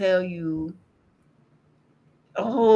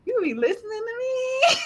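high, squealed delight at God; laughing, high-pitched joy; giddy squeal; 14 semitones higher than usual. Retold or imitated. Giddy retold cry to God.

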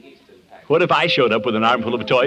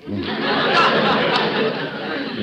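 a rising, teasing laugh; amused laugh; 11 semitones higher than usual. Acted. Laughing at Peavy's marriage advice, amused. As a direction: laugh at the old druggist.

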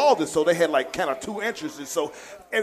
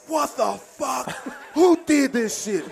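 higher, slow outraged mock yell, laughing through it; imitating an angry coach; 5 semitones higher, slower than usual. Coach jumping up from the burrs, laughing mock outrage. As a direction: blurt it out like a coach who just sat on burrs. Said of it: "he said".

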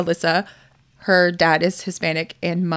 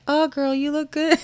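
fake compliment; 7 semitones higher than usual. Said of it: "to just be like".

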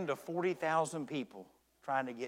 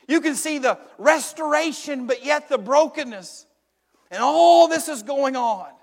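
passionate preaching; 8 semitones higher, 12 dB louder than usual. Acted. Impassioned sermon climax.

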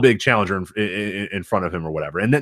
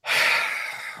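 long breathy sigh; heavy exhale before answering; 7 dB quieter than usual. Real, not acted. Hesitating before answering hard question.

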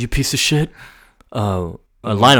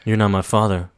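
ordinary talk

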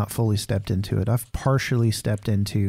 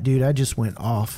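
normal talking voice

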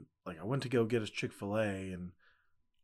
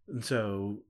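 ordinary talk, nothing marked

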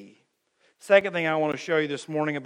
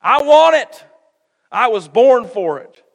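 much higher, louder grasping cry; greedy demand; 5 semitones higher, 11 dB louder than usual. Retold or imitated. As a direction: grab for the crown like it's owed you. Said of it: "Saul could have been like".

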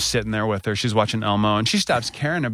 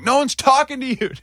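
very high, mock-indignant retort; hammed-up indignant comeback; retold angry comeback; 3 dB louder than usual. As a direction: replay an argument, voice jumping up. Retelling a snap back at assistant.

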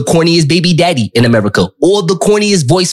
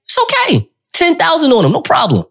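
teasing; 10 semitones higher than usual. Pretend. Teasing reassurance, building.

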